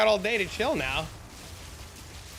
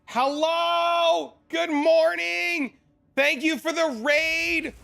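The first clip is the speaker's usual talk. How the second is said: high, slow, bright hello to the raider; cheery greeting; 9 semitones higher, slower than usual. Real, not acted. Streamer welcoming the raiding streamer, high and slow. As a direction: sing out a welcome, stretched and high.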